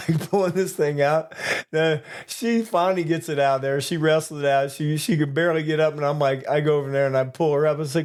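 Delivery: usual speaking voice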